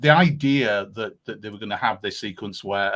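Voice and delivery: ordinary talk, nothing marked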